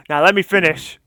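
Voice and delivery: normal talking voice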